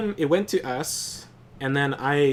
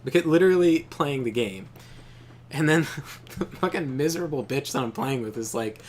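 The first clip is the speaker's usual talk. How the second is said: ordinary delivery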